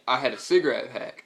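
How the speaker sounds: usual speaking voice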